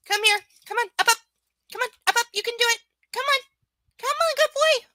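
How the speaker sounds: very high, loud coaxing pet voice; sing-song encouragement to a puppy. Real, not acted. Coaxing dog, rising baby talk.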